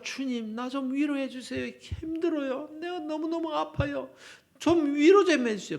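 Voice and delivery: high-pitched acted plea for comfort; pleading prayer. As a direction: play a hurting believer begging the Lord. Voices a sufferer's plea to God. (높은 음으로 애원하는 기도 흉내)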